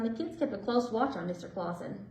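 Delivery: ordinary delivery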